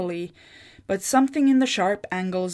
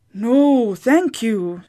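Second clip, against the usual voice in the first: firm; 3 semitones higher, 5 dB louder, much slower than usual. A narrator's reading. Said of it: "Sigrid said firmly".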